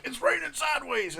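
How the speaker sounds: high, loud imitation scream; screamed bit, replayed. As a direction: scream the forecast as a comic callback. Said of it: "he just screamed".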